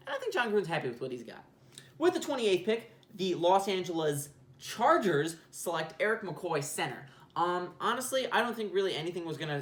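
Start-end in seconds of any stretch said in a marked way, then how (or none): 2.0-7.0 mock commissioner announcing the selection; draft announcer. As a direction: announce the pick like it's draft night.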